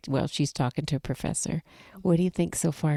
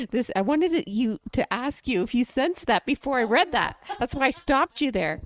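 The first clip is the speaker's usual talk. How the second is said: excited; 6 semitones higher than usual. Real, not acted. Excited after reveal.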